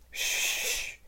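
sharp whispered shush; shushing hiss. Acted. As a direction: hush them fast. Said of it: "said the beaver".

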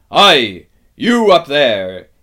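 very loud, drawn-out hail; bawling roar. A narrator's reading. Aslan calls up at the giant, roaring hail. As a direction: holler up to someone far above. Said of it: "bowled up at the giant".

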